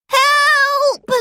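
high squeaky cry for help; stifled squeal. Acted. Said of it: "he squeaked".

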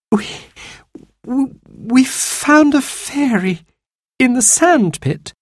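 put-on high, halting child near tears; small, high, crying-child voice; tearful. A narrator's reading. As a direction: stammer out a slow confession. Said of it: "Jane started to cry".

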